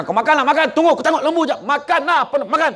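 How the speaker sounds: high, loud gruff urging to eat; gruff, angry-sounding. Retold or imitated. Villager telling his guest to eat, gruff and loud. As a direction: bark the invitation as if annoyed but meaning kindness. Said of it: "Dia bukan marah".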